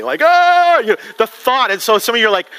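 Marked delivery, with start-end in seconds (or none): 0.2-0.8 high-pitched "oh" of mock horror; mock horrified cry. Preacher reacting to the buried-alive story, mock horrified cry. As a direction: cry out high at the creepy thought.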